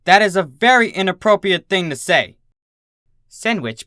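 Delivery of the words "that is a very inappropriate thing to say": high, loud disapproving teacher; stern scolding. A narrator's reading. Teacher reprimanding Dinky before the class, loud and stern. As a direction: scold her firmly in front of the class.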